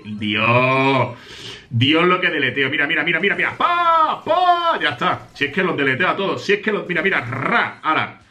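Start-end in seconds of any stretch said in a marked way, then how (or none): 3.6-4.8 imitated gunshots. Real, not acted. Gamer voicing his own shots, sharp high pa. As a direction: fire each pa out sharp and high.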